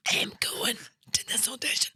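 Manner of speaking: whispered, no voice left; voiceless croak. Retold or imitated. Girl with no voice to her mum, whispered croak. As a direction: rasp it out with a lost voice. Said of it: "I had no voice".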